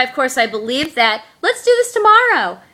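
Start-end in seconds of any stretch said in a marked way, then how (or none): 1.4-2.6 imitating a fake friend in a high, put-on voice; phony friend. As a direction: make the excuse sound fake, pitched way up. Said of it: "she gave me a very phony hello".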